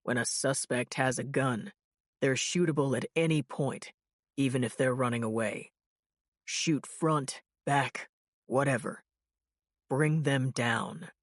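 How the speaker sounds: a veteran chief laying down rules; stern police chief. Retold or imitated. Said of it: "he'd told our squad".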